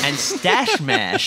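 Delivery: slow, lazy stoner drawl on stash mash. Retold or imitated. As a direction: savour the words like a stoned friend. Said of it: "That was how he, he said it".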